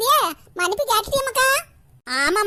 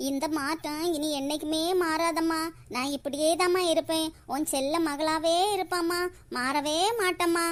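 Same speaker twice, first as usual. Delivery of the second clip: soft promise; 4 semitones lower, 6 dB quieter than usual. Acted. Daughter promising her mother.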